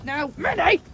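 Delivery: scolding call. Real, not acted. Farmer telling off greedy Minnie, loud high scold. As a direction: call her off the food. Scolding sheep stealing food.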